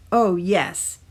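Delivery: usual speaking voice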